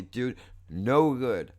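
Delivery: usual speaking voice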